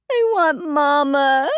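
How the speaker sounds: put-on high, slow, wailing little-girl voice; sobbing child. A narrator's reading. Addie crying to Caroline for Mama, sobbing child voice. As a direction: cry for her mother like a heartbroken five-year-old. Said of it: "Addie sobbed".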